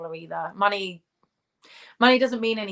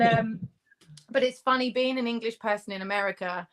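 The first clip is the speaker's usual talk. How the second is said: ordinary talk, nothing marked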